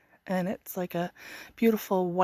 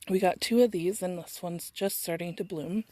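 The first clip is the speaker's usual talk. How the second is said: ordinary talk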